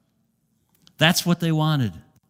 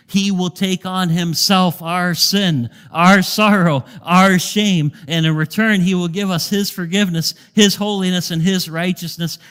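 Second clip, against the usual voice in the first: higher and louder, building sermon cadence; rising preaching; 4 semitones higher, 4 dB louder than usual. Acted. Pastor proclaiming the exchange to church, swelling fervor. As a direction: lift the congregation with each phrase.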